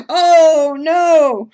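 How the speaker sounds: high loud oh no; hammed-up alarm at a pattern sale; mock alarmed shout. Real, not acted. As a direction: cry out at temptation.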